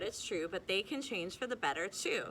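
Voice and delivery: plain reading voice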